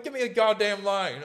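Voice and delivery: loud, high-pitched comic outburst; mock exasperated demand. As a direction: belt it out high, as a retold gripe. Retold outburst of frustration.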